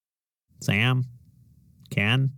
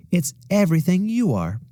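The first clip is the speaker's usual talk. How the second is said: reassuring; 9 semitones higher, 3 dB louder than usual. Acted. Mother comforting daughter.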